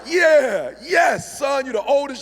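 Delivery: high, loud excited yes; excited cheer. Retold or imitated. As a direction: cheer with delight. Excited dad at report card.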